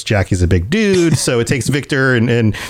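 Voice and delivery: ordinary talk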